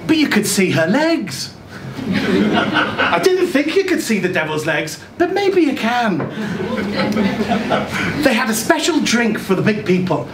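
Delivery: ordinary delivery, nothing marked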